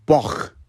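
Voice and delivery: "Bach" ending in a throaty scrape. Shown as a demonstration. Teacher correcting the American K, throaty "Bach". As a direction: let the end of "Bach" rasp in the throat. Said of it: "it's very throaty".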